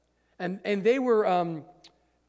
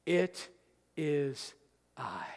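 hushed reveal; 6 semitones lower, 7 dB quieter, much slower than usual. Acted. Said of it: "But then he says this".

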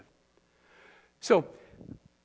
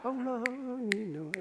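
put-on a soft, half-hearted Southern accent; 4 semitones higher, 8 dB quieter, slower than usual. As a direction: try a Southern drawl on Oh, Lord, much softer. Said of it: "like, a Southern accent".

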